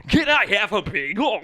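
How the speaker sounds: loud, high mock Chinese tones; exaggerated mock Chinese accent. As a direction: belt out a clumsy fake Chinese accent. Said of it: "I learned a little bit of, uh, like, Chinese".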